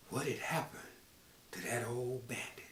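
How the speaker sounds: hushed storyteller whisper. A narrator's reading. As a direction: lean in and whisper the mystery. Suspense question to listeners.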